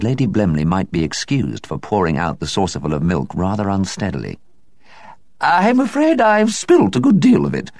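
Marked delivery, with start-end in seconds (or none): 5.4-7.7 high, flustered apology; high nervous hostess apology; apologetic. A narrator's reading. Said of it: "she said apologetically".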